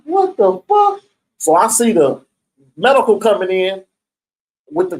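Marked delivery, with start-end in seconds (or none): 0.0-1.0 high, stunned disbelief; shocked outcry. Retold or imitated. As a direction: react in horror, pitched way up. Reacting to beheading news.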